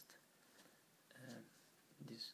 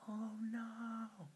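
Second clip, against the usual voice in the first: high, loud shocked cry; 10 semitones higher, 8 dB louder than usual. Acted. King seeing the giant bird behind the door, shocked cry. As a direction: gasp it out in horror at the bird. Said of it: "he was shocked".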